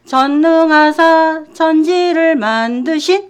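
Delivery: slow chanted Apostles' Creed; slow creed recitation. Shown as a demonstration. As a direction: recite the creed slowly, line by line. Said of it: "사도신경도 여러분 아시죠". (사도신경을 천천히 낭송하듯)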